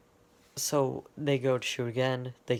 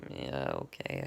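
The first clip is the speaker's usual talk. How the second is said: much lower, grudging mock grunt; Roadhog; 11 semitones lower, 4 dB quieter than usual. Roadhog shrugging off Junkrat in a much lower grudging voice. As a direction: drop way down into a reluctant brute. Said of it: "Roadhog says".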